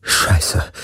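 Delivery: voiceless, dry-mouthed curse; hoarse unvoiced swear; dry-throated curse. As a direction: try to get it out with a dry throat. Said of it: "mit trockener Kehle zu sagen".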